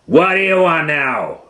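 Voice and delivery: exasperated teacher gorilla; annoyed gorilla. Acted. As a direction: snap it out, fed up.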